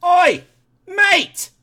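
high, loud British-style holler; calling out. Retold or imitated. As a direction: hail a pal across a crowded hall. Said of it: "I hear this voice".

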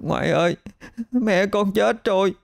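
put-on high, sobbing child's plea, slowing; a nine-year-old breaking down in tears to grandma; high-pitched tearful wail of a grieving boy; child crying. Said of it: "cậu bật khóc".

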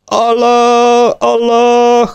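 very high, loud battle roar; war cry. A narrator's reading. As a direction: yell it like a charging horde. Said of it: "iszonyú ordítás hallatszott".

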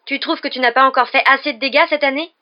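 loud, high, furious exclamation. A narrator's reading. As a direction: explode in angry accusation. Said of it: "s'exclama Ron avec fureur".

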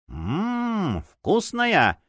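high squeal, savoring the taste; a hungry monster card squeals with delight; squealing card creature. A narrator's reading. A card creature squeals over its prey, savoring. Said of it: "провизжала одна из них".

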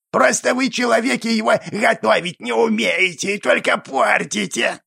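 creaky goblin voice. A narrator's reading. Goblin character lectures.

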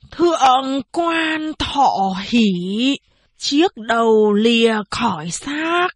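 slow, grave tolling announcement; voice suddenly drops low. A narrator's reading. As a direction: drag each word out like a death notice. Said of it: "Giọng bà Cầu đột nhiên trầm hẳn xuống". (giọng trầm hẳn xuống, chậm rãi)

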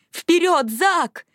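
high, excited shout of support; eager trackside cry; urging cheer. Acted. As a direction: cheer the runner on, pitched well up. Cheering a runner on.

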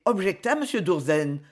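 ordinary delivery, nothing marked

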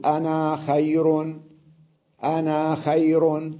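"أنا" said with a drawn-out a, slowly; long ana. Shown as a demonstration. Teacher demonstrating to students the long alif in "أنا". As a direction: stretch the a in "أنا" and keep it in linked speech. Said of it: "يقول".

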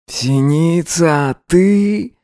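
put-on awestruck young man. Vova stunned by her look.

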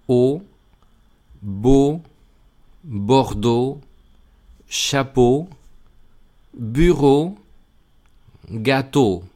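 beau, Bordeaux, chapeau, bureau, gâteau each ending in a closed French O; French EAU said as O. Shown as a demonstration. Teacher demonstrates EAU words for Spanish learners, careful French. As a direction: say every EAU as a clean closed O. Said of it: "Con E A U tenemos".

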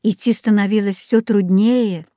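plain reading voice

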